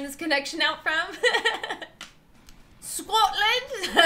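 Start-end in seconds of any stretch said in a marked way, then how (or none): 2.8-4.0 high, badly faked Scottish accent; mock Scottish accent. Streamer teasing a Scottish chatter about their home, mock Scottish accent. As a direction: put on a hammy Scottish burr. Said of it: "It's my Scottish accent".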